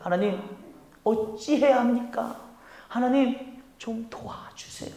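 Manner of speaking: soft, slow pleading prayer; humble prayer. Shown as a demonstration. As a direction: pray humbly under your breath. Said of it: "이렇게 기도하며 겸손히". (조용히 간절하게 드리는 기도)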